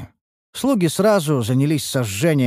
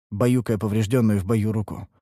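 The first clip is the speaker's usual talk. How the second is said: plain reading voice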